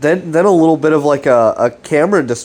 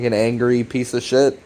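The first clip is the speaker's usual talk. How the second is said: ordinary talk, nothing marked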